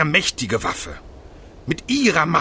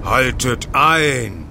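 slow, drawn-out command; commanding halt; slower than usual. Acted. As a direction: order them to stop, stretching the words. Armoured figure halts the fight.

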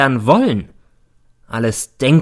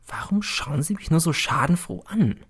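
hushed, suspicious whisper; a baffled whisper; 5 semitones higher, 6 dB quieter than usual. Acted. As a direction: breathe the question under your breath. Teacher wonders at grinning class.

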